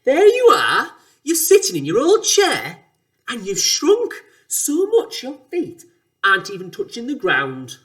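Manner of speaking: loud taunting shout with swooping pitch. A narrator's reading. Mister Twit points at his wife in her chair, shouting. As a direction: shout it out as an accusation. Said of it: "pointed at her and shouted".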